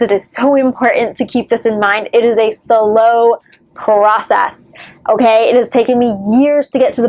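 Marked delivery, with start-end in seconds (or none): 2.5-4.7 "slow" and "process" drawn out slowly; stress on slow. Shown as a demonstration. Vlogger repeating the key word for viewers, "slow process" heavily drawn out. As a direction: stretch "slow process" out for emphasis. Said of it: "gonna repeat that word many".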